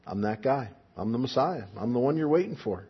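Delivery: low, quiet and intimate; soft revelation. Retold or imitated. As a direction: confide it gently. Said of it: "Jesus very clearly says to".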